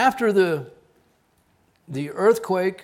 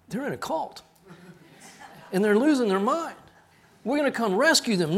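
mock alarmed parents; 7 semitones higher, 4 dB louder than usual. Parents worried about cult.

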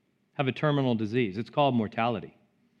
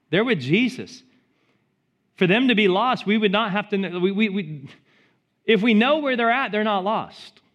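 louder and higher, driving the point home; fervent preaching; 7 semitones higher, 4 dB louder than usual. Real, not acted. Pastor insisting to the congregation, loud preaching. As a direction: preach it hard, building to a cry.

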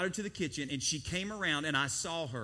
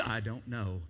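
put-on sheepish child; 9 semitones lower, 4 dB quieter than usual. Son's small answer.

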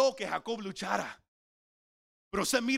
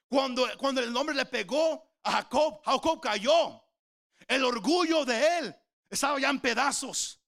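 higher, louder, fervent preaching; preaching at full force; 6 semitones higher, 4 dB louder than usual. Real, not acted. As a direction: drive the point home from the pulpit.

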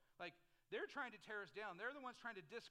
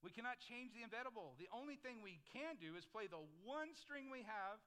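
ordinary talk, nothing marked

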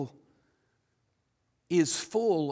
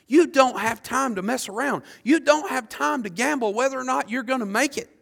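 higher, louder urgent sermon; urgent preaching; 6 semitones higher, 4 dB louder than usual. Acted. Preacher urging the lost listeners, urgent and loud. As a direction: press the lost to decide now.

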